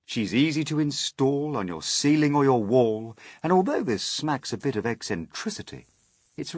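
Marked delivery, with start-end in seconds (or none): none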